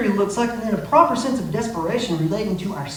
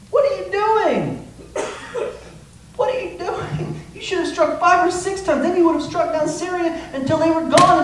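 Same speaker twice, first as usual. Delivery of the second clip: much higher and louder, furious disbelief; mad Elisha; 10 semitones higher, 4 dB louder than usual. Acted. Elisha scolding Joash for stopping, angry shouting. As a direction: rage at the king like a scolding prophet. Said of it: "look how mad he gets".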